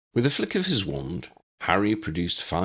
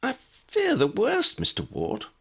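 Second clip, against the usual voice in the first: anxious neighbour. A narrator's reading. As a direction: cut in with high dread. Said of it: "she interrupted".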